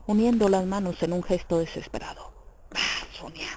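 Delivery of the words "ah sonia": soft disdainful sigh of a reply. A narrator's reading. Raskolnikov answers Sonia's question about killing, with quiet disdain. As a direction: brush it off in a low breath of disdain. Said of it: "repuso con desdén".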